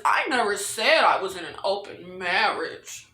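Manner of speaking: low, hushed imitation of a character; quiet mock quote. Recapper imitating Miranda's denial, low and soft. Voices Miranda's retold line.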